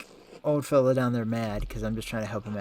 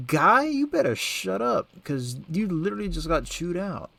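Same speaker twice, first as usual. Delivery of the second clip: mock taunt; 6 semitones higher, 4 dB louder than usual. Mocking a monk character.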